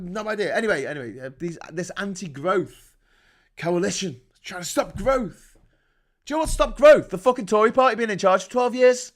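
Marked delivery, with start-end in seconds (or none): 6.2-9.1 shouted, higher and louder; furious rhetorical shout; angry rant. Real, not acted. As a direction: bellow the question at them. Ranting about the Tories.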